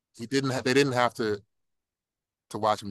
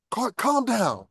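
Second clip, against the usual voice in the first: calling out to Clark; 10 semitones higher, 4 dB louder, much slower than usual. Retold or imitated. Father calming Clark.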